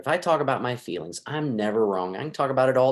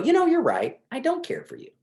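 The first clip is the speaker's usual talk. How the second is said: much higher, sing-song mock agreement; mock spouse voice. Imagined spouse absurdly agreeing with criticism, in a high mock voice. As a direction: play the spouse who would never say this, pitched way up.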